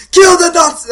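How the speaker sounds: very high, loud mock scream; hammed-up screamed movie line; screaming villagers. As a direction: shriek it like a riled-up mob. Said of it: "screaming other lines from".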